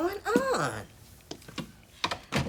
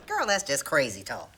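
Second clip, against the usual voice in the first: normal talking voice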